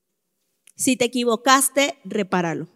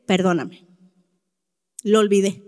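dad's apology voiced lower; father apologizing; 6 semitones lower than usual. Father apologizing to his crying daughter, in a lower voice. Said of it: "Y me dice".

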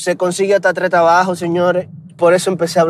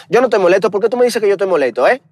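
fast Dominican Spanish, higher, s sounds dropped in "moleto"; full Dominican accent; 6 semitones higher, much faster than usual. Retold or imitated. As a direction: rattle it off like a true Dominican. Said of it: "si lo digo dominicano, dominicano, mira". (acento dominicano cerrado y rápido)

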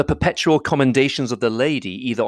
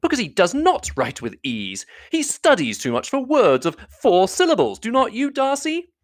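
high, animated teasing; lively, high-pitched brotherly banter; brother crying out; 12 semitones higher than usual. A narrator's reading. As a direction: jump in brightly to tease a friend. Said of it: "cried her brother".

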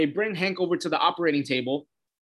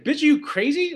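high, incredulous outburst; exasperated; 12 semitones higher than usual. Retold or imitated. Vlogger scolding Dove in disbelief, high and exasperated. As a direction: fling it at her in disbelief.

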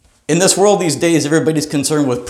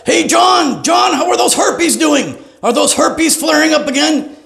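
booming doctor shout; 11 semitones higher than usual. Retold or imitated. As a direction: shout it across the clinic. Loud doctor voice, shouting privates.

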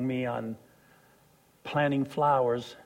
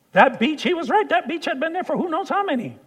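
preaching excitement; 13 semitones higher, 6 dB louder than usual. Real, not acted. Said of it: "preaching climax".

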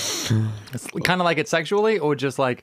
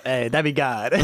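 put-on Boston accent through a laugh; Boston accent, old gangster style. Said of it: "talking in a Boston accent".

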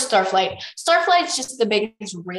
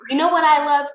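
mild mock-mournful dragon line, pitched up; 7 semitones higher, 8 dB louder than usual. A narrator's reading. Clay the dragon pines for fish, mournful. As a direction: lightly lament it as you read. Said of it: "Clay said mournfully".

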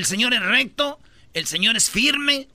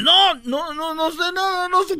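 high frantic pleading; higher mock begging of a suspect; 8 semitones higher, 3 dB louder than usual. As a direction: beg not to be dunked again. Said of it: "te sacan y otra vez".

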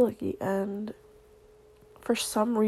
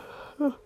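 a long open-mouthed yawn; 5 semitones higher than usual. Real, not acted. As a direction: yawn audibly mid-message. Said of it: "Sorry, I yawned".

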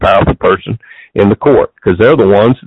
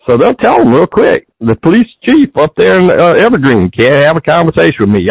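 ordinary delivery

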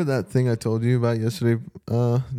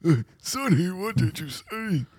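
put-on higher, softer old man voice; grumpy old man; 6 semitones higher, 6 dB quieter, slower than usual. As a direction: creak it out like a deaf grandpa. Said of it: "old man voice".

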